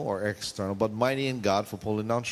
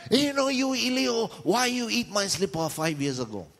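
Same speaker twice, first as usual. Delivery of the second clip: high-pitched comic scolding; mock yelling; 10 semitones higher than usual. Preacher mimes scolding a long-buried dog, mock yelling. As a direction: yell in mock outrage, pitched way up. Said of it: "It's like yelling at your dog".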